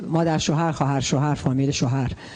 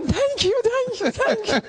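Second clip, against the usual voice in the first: high, drawn-out thank-yous; mock effusive gratitude, very slow; grateful thanks; much slower than usual.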